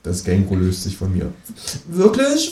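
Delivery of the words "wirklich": much higher, cartoonish kangaroo voice. Kangaroo asking the narrator, in a much higher voice. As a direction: pitch it way up like a cheeky kangaroo.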